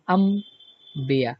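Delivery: अम्बिया stretched slowly, m sound clear before b; drawn-out demo. Shown as a demonstration. Teacher slowly models अम्बिया for students. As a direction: draw out अम्बिया slowly, lingering on the m. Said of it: "क्या पढ़ रहे हैं?".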